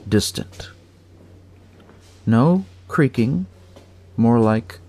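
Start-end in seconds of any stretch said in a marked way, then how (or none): none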